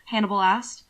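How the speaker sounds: usual speaking voice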